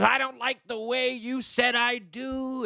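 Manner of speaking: high, drawn-out complaint; mock quarrelling bride. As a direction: imitate a new wife picking a fight, slowly. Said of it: "they're fighting already. She says".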